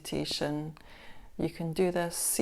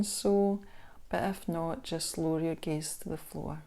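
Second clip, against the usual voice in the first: unhurried body-scan guidance with long pauses; guided meditation; 5 dB quieter than usual. Acted. Instructor guiding listeners through a body scan meditation, calm guided meditation voice. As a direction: ease the listener into stillness, leaving space between phrases.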